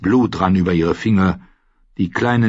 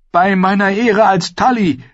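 high, loud solemn vow; desperate oath; 11 semitones higher, 5 dB louder than usual. A narrator's reading. Mother offers the lord a bargain, desperate vow. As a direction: swear it urgently.

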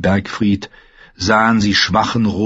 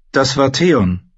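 slow, grim reply, pitched up; gloomy; 5 semitones higher, slower than usual. A narrator's reading. Bran names who did this, gloomy and slow. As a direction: say it darkly, drawing it out. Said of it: "erwiderte Bran düster".

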